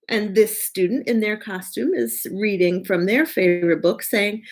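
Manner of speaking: plain reading voice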